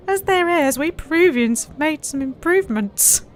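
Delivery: pitched well up as the boastful pilot; Peruvian pilot voice. Acted. Pilot boasting to the traveller, in a high put-on voice. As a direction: play the cocky pilot showing off.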